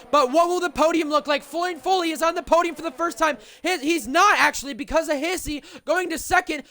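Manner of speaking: rising, excited finish-line call; motorsport commentator. Real, not acted. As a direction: build up to the win. Winner call, building up.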